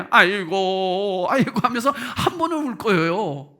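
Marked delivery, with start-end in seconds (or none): 0.1-1.6 long, raised "aigo" lament; mock wailing. Preacher imagining Mary weeping once at the grave, mock wail. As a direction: stretch out a pretend mourner's wail. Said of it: "한 번은 울겠지".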